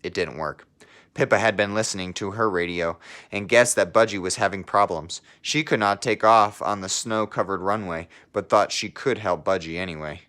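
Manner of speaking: put-on lively read-aloud voice for children; storybook narration. Teacher reading a picture book to her class, storybook narration. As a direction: tell the story to a class of kids.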